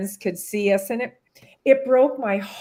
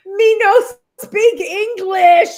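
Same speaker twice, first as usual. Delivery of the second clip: imitating broken English, much higher, louder and slow; mock broken English; 10 semitones higher, 9 dB louder, slower than usual. Harry brushing someone off, imitated in broken English. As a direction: ham up a broken-English reply. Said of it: "and he goes".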